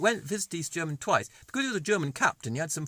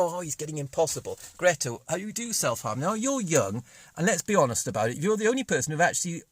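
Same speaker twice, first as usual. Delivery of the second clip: ordinary talk, nothing marked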